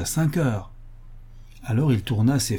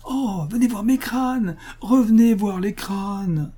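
lancé plus haut et lentement, appelé de loin; appelle-le encore, haut et traînant; crié au loin; 7 semitones higher, slower than usual. A narrator's reading. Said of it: "je l'entendis qui criait encore". (cri lent et aigu vers le fuyard)

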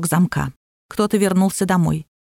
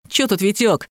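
ordinary delivery, nothing marked

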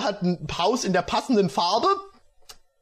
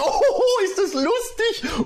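high, laughing delight; giggling character voice; hearty laugh; slower than usual. Acted. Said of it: "Atreo lachte herzig".

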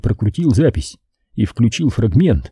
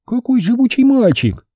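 томно; 12 semitones higher than usual. Acted. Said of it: "томно похвалила Лиза".

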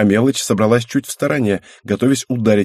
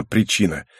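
plain reading voice

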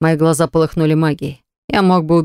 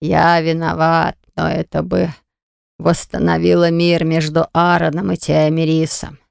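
put-on old man voice; slower than usual. Oracle admits guilt.

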